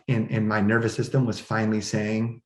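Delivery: normal talking voice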